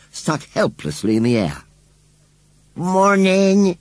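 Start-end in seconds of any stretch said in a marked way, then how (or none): 2.8-3.8 high, loud wheezy "Morning" from a scarecrow; wheezed greeting. Acted. Scarecrow greets the children from the rubbish heap, wheezing. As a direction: wheeze out the word like a creaky old scarecrow. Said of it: "he wheezed".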